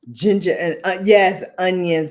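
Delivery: ordinary delivery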